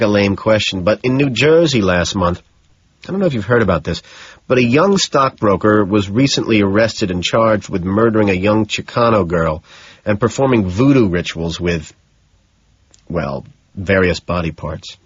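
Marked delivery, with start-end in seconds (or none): none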